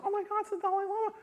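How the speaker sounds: high, quick panicked realization; acted-out rush of horror; horrified. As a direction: squeal it in mock horror. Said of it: "I was horrified".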